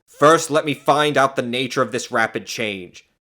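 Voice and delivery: ordinary delivery, nothing marked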